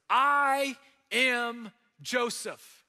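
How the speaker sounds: slow, loud, drawn-out I am Joseph; weeping reveal. A narrator's reading. Joseph revealing himself to his brothers, slow and loud. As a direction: break it open slowly and loudly. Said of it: "he wept so loudly".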